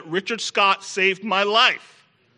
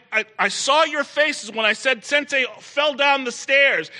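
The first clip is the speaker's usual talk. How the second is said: ordinary delivery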